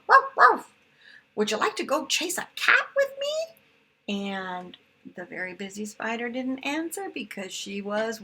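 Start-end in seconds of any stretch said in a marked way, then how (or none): 0.1-3.3 high, loud, quick puppy voice; excited dog. Acted. The dog inviting the spider to chase a cat, high and eager. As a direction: bark and chatter like an eager puppy.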